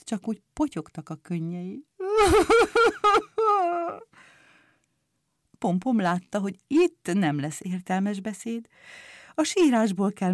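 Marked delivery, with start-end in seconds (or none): 1.9-4.0 high, loud mock wail; acted, drawn-out boohoo crying; bursting into tears. A narrator's reading. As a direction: bawl like a little character. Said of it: "Picúr újra sírva fakadt".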